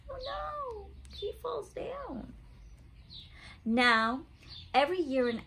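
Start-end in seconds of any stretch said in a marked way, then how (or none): 0.1-2.3 mock dismay. Reacting to giraffe falling.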